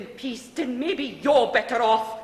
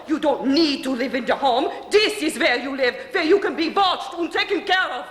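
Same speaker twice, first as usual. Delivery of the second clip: insistent raised voice; 5 semitones higher, 7 dB louder than usual. Acted. Mother insists Bella stays home.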